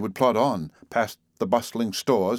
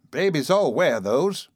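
prim; 7 semitones higher, 4 dB louder, slower than usual. A narrator's reading. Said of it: "said the nurse primly".

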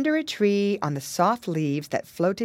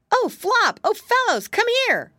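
high, loud excited call to friends; 5 dB louder than usual. Acted. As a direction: call the gang over, thrilled. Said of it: "called Curly".

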